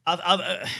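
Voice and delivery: ordinary talk, nothing marked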